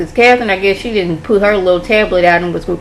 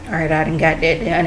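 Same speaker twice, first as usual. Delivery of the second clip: dropping the act; 4 semitones lower, 6 dB quieter than usual. Retold or imitated. Frank drops the act past cameras.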